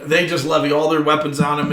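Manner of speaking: ordinary talk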